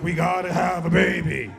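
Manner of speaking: loud, forceful girlfriend imitation; hammed-up aggressive demand; mock aggressive. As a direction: push it out like a charging linebacker. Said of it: "just aggressively like".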